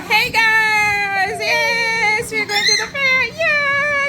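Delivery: high, loud cheering shout; excited shout. Real, not acted. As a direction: yell it out thrilled to be going. Cheering on the way to the fair.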